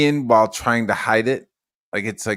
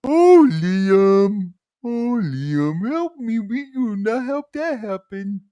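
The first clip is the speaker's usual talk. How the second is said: high pleading put-on wife voice; wailing wife, mock distress; wife's voice; 7 semitones higher than usual. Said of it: "in Liam's wife's voice".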